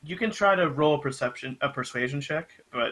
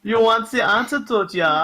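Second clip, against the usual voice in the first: put-on German accent; 8 semitones higher than usual. GM voicing the bounty hunter to the players, in a laughing German accent. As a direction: lay on a thick German voice, laughing. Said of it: "the one you're giving him".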